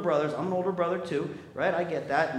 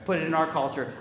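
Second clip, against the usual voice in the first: ordinary talk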